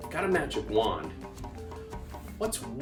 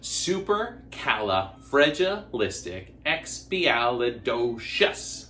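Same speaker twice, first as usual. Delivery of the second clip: slow, syllable by syllable; 3 semitones lower, much slower than usual. Shown as a demonstration. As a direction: spell it out slowly for kids. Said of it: "we'll say it one more together".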